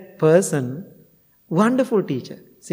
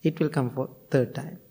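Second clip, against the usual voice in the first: usual speaking voice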